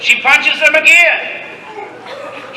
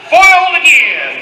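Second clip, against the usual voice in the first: villain shout; 5 semitones higher than usual. Acted. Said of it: "The villain shouts".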